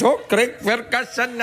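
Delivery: mocking impression of a botched accent; high, drawn-out, hammed-up attempt at an accent; Regis Philbin. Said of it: "he thinks he's doing my accent".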